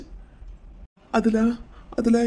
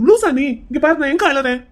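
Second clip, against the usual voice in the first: indignant denial; 4 semitones higher, 5 dB louder than usual. Acted. Indignantly denies that he is crying.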